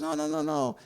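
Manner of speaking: high-pitched retold denial; quick denial. Wife voiced denying he is there.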